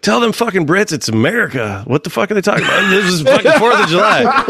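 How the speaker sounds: high-pitched, worked-up rant of a patriotic vet; riled army-vet buddy. Retold or imitated. Army vet buddy objecting to talk with Brits, riled-up imitation. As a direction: imitate your indignant veteran buddy, pitch way up. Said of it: "he's an army vet".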